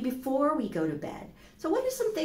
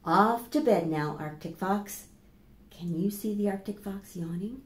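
put-on reading to children; slower than usual. Teacher reading a bedtime book to children, gently.

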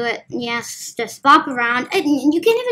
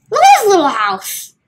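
delighted; 6 semitones higher, 9 dB louder than usual. Real, not acted. Delighted at game house.

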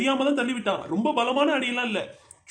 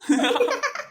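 laugh; 5 semitones higher than usual. Real, not acted. Friends chatting, teasing.

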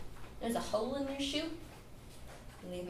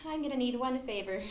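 normal talking voice

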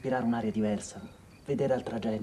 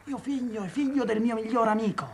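esclama; 9 semitones higher than usual. Retold or imitated.

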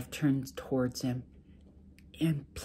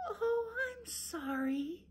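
high, soft, slow and sweet; sugar-sweet apology to her husband; wife apologizing very sweetly, soft and high; 6 dB quieter, slower than usual. Acted. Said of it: "she said very sweetly".

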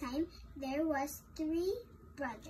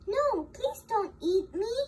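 lightly acted plea, higher and louder; pleading middle goat; 5 semitones higher, 5 dB louder than usual. Middle goat begging the troll, higher, louder plea.

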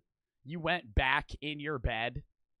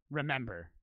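low, soft, ominous mom imitation; dead serious; 7 semitones lower, 6 dB quieter than usual. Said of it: "in a dead ser".

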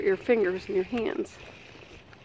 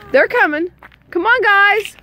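calling out; 11 semitones higher, 7 dB louder, slower than usual. Real, not acted. Telling the guys to come along, calling out. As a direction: holler for the group to follow.

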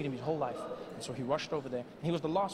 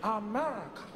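husky AMERICA in usual pitch; husky model. Acted. Mandela giving Obama the word to copy, husky. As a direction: put husk into AMERICA. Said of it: "Now, repeat after me".

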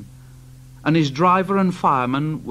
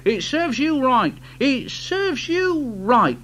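scolding; 10 semitones higher than usual. Acted. Thomas scolding.

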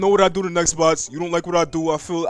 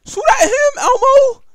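urgent shout; 9 dB louder than usual. Real, not acted. Yelling orders at ally.

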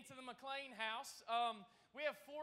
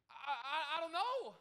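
mock panic; 6 dB louder than usual. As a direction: act out a flustered child in a panic.

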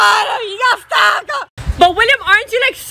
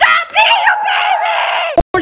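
much higher, slow laughing yell; laughing shout; 11 semitones higher, much slower than usual. Real, not acted. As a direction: laugh while telling him off. Laughing at William.

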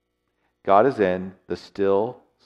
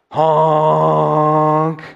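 loud, high foghorn blast; foghorn honk; 7 semitones higher, 8 dB louder than usual. Retold or imitated. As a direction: blare it out like a ship's horn. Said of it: "one of the loudest sounds I've ever heard".

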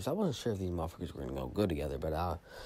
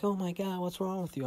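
much higher mom voice, alarmed; worried mom; 11 semitones higher than usual. Retold or imitated. Mom finding her hungover son, high worried voice. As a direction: voice the mother checking on her sick kid. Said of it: "my mom's like".

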